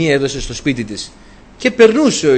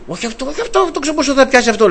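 retold father, pitched much higher, fussing over which animal; father's voice; 10 semitones higher, faster than usual. Father rejecting animals for the priest, higher mock voice. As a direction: imitate a picky father pointing at each animal.

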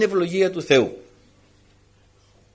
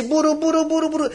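mock grumbling noise; 10 semitones higher than usual. Preacher mimicking a nagging wife, playful grumble sounds. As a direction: imitate endless nagging chatter. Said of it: "δεν είναι για τη μουρμούρα".

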